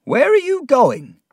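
very high, loud cry; alarmed question. A narrator's reading. Missus Gummidge sees Mister Peggotty leaving, alarmed. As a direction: call out in high-pitched alarm.

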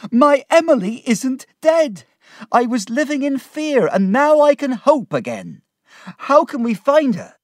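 very high, loud, emotional outburst; overjoyed relief. Acted. Peggotty tells friend his fear is over, overjoyed relief. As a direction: pour out the new hope loudly.

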